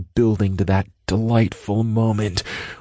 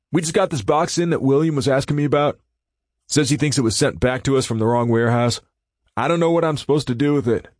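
put-on higher, fast young coworker voice; Tyler voice; 6 semitones higher, faster than usual. A narrator's reading. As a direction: rattle it off eagerly.

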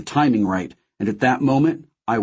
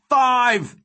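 high desperate countdown shout; tearful high-pitched yell; anguished yell; 13 semitones higher, 3 dB louder than usual. A narrator's reading. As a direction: cry out the number in anguish. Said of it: "Then he yelled".

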